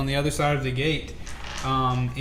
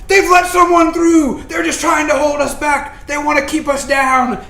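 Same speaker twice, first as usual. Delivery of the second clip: crowd shouting; 11 semitones higher, 9 dB louder than usual. Acted. GM voices angry crowd.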